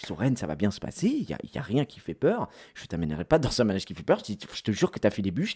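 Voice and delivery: low, soft calming voice; quiet, soothing reassurance; reassuring. Retold or imitated. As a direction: talk a scared kid down, hushed and low. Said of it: "Et moi, je le rassure".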